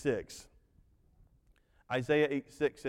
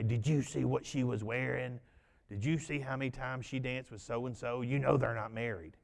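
gossiping grandmother; 4 semitones lower, 6 dB quieter than usual. Retold or imitated. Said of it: "She would say".

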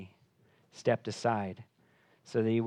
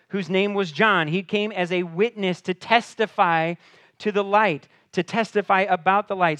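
loud, high, rising pulpit preaching; preaching at full force; 5 semitones higher, 9 dB louder than usual. Real, not acted. Pastor preaching John's mission to the congregation, loud and high. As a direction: preach it out to the whole room.

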